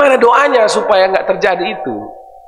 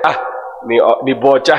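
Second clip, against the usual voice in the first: lower, lightly voiced indulgent God; a hint of a fond elder; God chuckling at a child; voicing God, amused; 8 semitones lower than usual. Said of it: "kata Allah".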